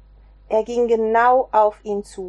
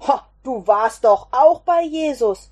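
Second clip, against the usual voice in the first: louder, in another man's voice, pointing him out; accusing call; 3 semitones higher, 6 dB louder than usual. Acted. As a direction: call him out across the yard. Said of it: "zeigte ein Mann auf ihn und rief". (lauter, anklagender Ruf)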